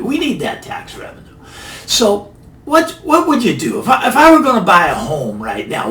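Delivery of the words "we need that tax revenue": a quieter, half-breathed repeat of We need that tax revenue; soft aside. Shown as a demonstration. Commentator repeating the point about tax revenue, quiet aside.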